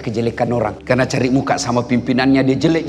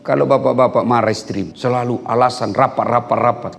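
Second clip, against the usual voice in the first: normal talking voice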